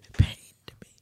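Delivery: mock-pained whisper. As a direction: whisper it as a wounded aside. Repeats mock confession under co-host.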